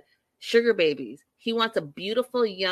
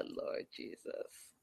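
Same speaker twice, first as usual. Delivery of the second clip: exasperated whisper; 18 dB quieter, much slower than usual. Real, not acted. Exasperated aside after the recap.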